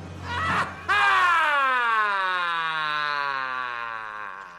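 big shouted laugh. Real, not acted. Big laugh after quote.

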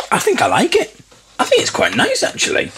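high, surprised approval; surprised by the taste; pleasantly surprised. Real, not acted. Tasting cashew milk, surprised.

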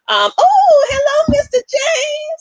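thrilled to see Mister James join; excited greeting. Real, not acted. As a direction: squeal a happy welcome. Greets guest joining live.

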